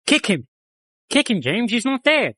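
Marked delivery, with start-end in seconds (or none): none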